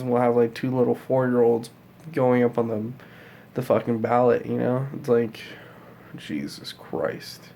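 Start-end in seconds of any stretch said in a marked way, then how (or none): 6.2-7.5 slow, low, breathy "Jesus Christ"; exasperated whisper. Real, not acted. Vlogger despairing over the election, whispered slowly. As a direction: let it out under your breath in disbelief.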